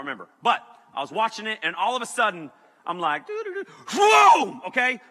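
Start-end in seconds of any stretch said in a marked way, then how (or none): 3.3-3.6 high, light doo-doo-doo; carefree humming. Retold or imitated. Carefree humming before turbulence. 4.0-4.6 high, very loud whooshing boom; shouted sound effect; explosive turbulence noise. Retold or imitated. Mimics sudden turbulence.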